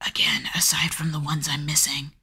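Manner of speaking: whispering under her breath; whispered aside. Real, not acted. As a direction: drop to a quick whisper. Whispered aside about missing clips.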